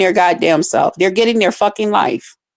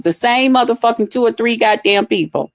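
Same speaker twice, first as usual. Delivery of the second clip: ordinary delivery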